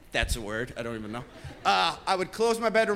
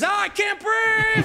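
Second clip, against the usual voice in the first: put-on high whiny kid's yell, much higher; mock yell; 12 semitones higher, 3 dB louder, slower than usual. Comedian imitating his little brother yelling out. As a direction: wail it like a little brother faking distress.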